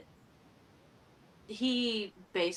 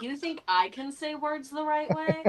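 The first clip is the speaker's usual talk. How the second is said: mock offended; 16 dB louder than usual. Mock offence at accent jab.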